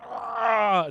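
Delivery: high, loud hammed-up roar; mock flexing roar. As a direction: roar like a showing-off fighter. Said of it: "I was like".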